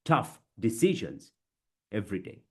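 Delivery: deliberate, one phrase at a time; chunked delivery. Shown as a demonstration. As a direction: separate chunks with long pauses. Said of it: "one chunk".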